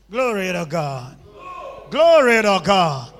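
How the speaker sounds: full loud shout of praise; shouting glory at the congregation. Real, not acted. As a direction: lift the room with it.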